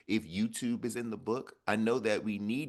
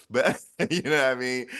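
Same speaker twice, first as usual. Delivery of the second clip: laughing; 6 semitones higher, 11 dB louder than usual. Real, not acted. Laughing at own joke.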